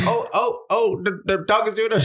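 high, flustered squeal; mock alarmed passerby. Passersby panicking as the puppy poos, high flustered squeal. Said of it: "And they're like".

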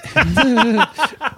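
high, loud burst of laughter; loud high-pitched laugh. Real, not acted. Laughs at own story.

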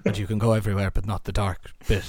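low Northern Irish Mufasa, grave and halting; Liam Neeson impression. Friend asked for his Liam Neeson, low grave Northern Irish voice. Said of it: "How's your Liam Neeson?".

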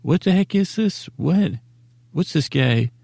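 high-pitched puzzled mock question; lightly hammed-up disbelief; mock confused listener. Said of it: "you say".

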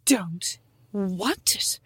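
whispered, slow "don't want it"; imitating Mom refusing the croissant; hushed Mom impression. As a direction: whisper it like a fussy mother.

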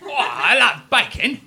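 hammed-up celebrity baker, breaking into laughs; TV baking judge voice. Joking about Paul Hollywood impressions.

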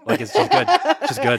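high squeaky laughing talk. Real, not acted. Cohost cracking up at her own joke, laughing. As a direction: laugh through the words.

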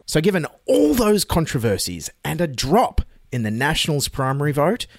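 normal talking voice

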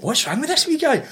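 high bewildered mock voice. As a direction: play the baffled agent. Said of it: "she must have thought".

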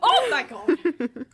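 real drawn-out, high, loud exclamation; laughing, high-pitched outburst of shock; startled exclamation. Real, not acted. Host reacting to a shriek, startled exclamation.

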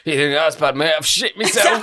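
cheeky mock confession; cheeky comeback. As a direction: deliver the joke deadpan then crack. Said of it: "just to go".

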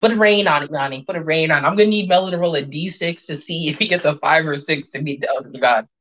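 usual speaking voice

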